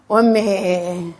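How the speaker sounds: ordinary talk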